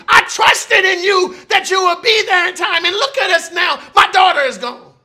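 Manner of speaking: loud, high cry of a desperate father; father's raw outcry at Jesus, loud and high; anguished father. Acted. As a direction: plead and accuse, voice rising. Grieving father confronts Jesus.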